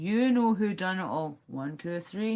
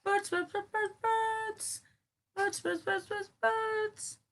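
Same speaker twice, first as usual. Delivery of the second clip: squeaky bird voice; 14 semitones higher, 4 dB quieter, much slower than usual. Pretend. Squeaky repeated birds, parrot.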